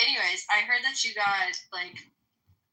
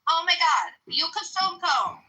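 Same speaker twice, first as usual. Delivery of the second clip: high, whiny mockery, pitched well up; mocking whiny attention-seeker; 6 semitones higher than usual. Mocking a streamer who wants attention, high and whiny. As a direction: parody him as a needy teenage girl. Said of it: "attention's not on me".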